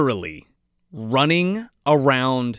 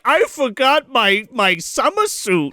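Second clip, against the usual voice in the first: slow, raised-pitch complaint; mock Santa; 6 semitones higher, slower than usual. Sweating Santa missing his summer suit, slow mock complaint. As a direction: drag it out in a hammed-up Santa voice. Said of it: "he's like".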